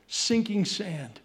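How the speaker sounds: ordinary talk, nothing marked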